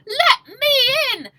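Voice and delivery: loud, high, slow cry; high, loud desperate yell; desperate shout. Acted. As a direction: hammer the door and yell. Banging fists on door.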